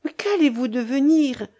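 narrated alarm, pitch lifted; horrified cry. A narrator's reading. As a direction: cry out in mild horror. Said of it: "s'écria madame Hamel épouvantée".

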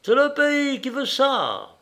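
high-pitched resigned remark, dropping at the end. A narrator's reading. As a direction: put it down to the country, resigned. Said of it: "d'un air résigné".

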